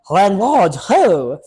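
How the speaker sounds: loud, high hammed-up French call; bad French accent. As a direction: call out for the landlord, badly French. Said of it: "I get to do bad French accents".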